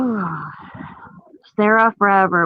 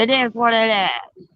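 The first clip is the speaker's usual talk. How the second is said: higher mimicked New York catchphrase; mock New York accent; 4 semitones higher than usual. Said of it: "instead of the fucking New York or something".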